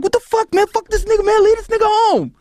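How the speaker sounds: high, heated mock outrage; mad crew. Junior Mafia at the airport, furious at him, high mock outrage. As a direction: voice the crew, fired up and yelling. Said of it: "These dudes are mad".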